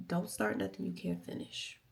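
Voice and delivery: ordinary talk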